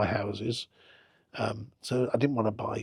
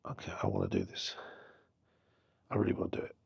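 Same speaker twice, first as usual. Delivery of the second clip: quiet resolve; 6 dB quieter, faster than usual. Retold or imitated. Retold private resolve, to himself.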